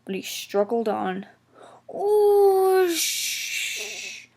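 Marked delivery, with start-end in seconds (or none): 1.9-4.3 high oh then a hissed sh; high startled hiss. A narrator's reading. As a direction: hiss as water hits the fire. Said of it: "he hissed".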